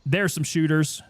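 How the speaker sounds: usual speaking voice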